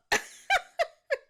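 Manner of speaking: shrieking high laugh, loud. Real, not acted. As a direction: burst out in a squealing laugh. Laughing at the game.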